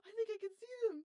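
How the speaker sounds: loud, high-pitched retold alarm; urgent report. Retelling sighting to the nine one one operator, urgent. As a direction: blurt it out in mock alarm.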